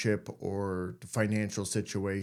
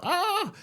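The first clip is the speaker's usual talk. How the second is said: sudden high yelp, imitating panic; a quick squeal of mock alarm; mock startled yelp. Seeing an ex's car, he yelps in high mock panic.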